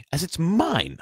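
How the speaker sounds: high, loud indignant claim; Harry furious. A narrator's reading. Boy claiming his own letter, furious. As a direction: insist angrily that it belongs to you. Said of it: "said Harry furiously".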